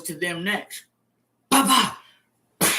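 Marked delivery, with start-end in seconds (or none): 1.5-2.0 mock slap. Imitating slap on other inmates.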